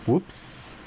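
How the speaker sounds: caught-out little whisper to yourself; whispered whoops. Acted. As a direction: let it slip out under your breath. Hides the knife secretly.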